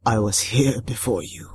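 low, hoarse water spirit; waterlogged creature voice, thickly accented; Tarabusao. A narrator's reading. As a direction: speak low as an ancient river creature. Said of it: "hoarse, waterlogged, speaking in an accent so thick".

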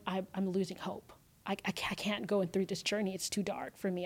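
soft, halting confession of hopelessness; retold despair. Woman telling stranger she's losing hope, quietly despairing.

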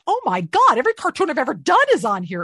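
high, loud burst of disbelief; amazed retelling. Retold or imitated. Actor recalls spinning through Disney Plus, amazed. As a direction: reenact a big astonished discovery. Amazed at finding own cartoons.

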